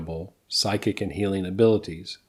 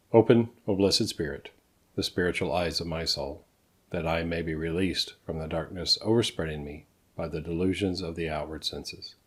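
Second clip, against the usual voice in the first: soft, measured reading of a prayer; prayer recitation; 2 semitones lower, 5 dB quieter than usual. Acted. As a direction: recite a solemn invocation, settling softer as it goes. Recites Reverend Bradley's request as prayer.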